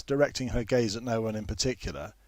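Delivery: usual speaking voice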